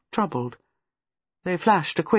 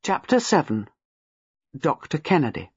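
usual speaking voice

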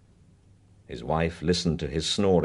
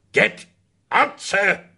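loud, higher furious shout; 13 semitones higher, 7 dB louder, slower than usual. Acted. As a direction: bellow him out the door. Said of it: "Mister Bingham shouted".

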